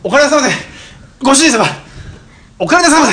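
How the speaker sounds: high, loud maid greeting under strain; sugary maid cafe greeting. Acted. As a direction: chirp it like a maid doing push-ups. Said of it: "お帰りなさいませ、ご主人様百回". (腕立てしながらのメイド挨拶)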